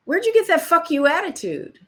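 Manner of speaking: mock the producer's indignant question; gruff producer. Producer quoted, retold meeting.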